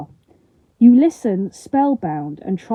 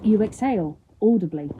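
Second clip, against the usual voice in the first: plain reading voice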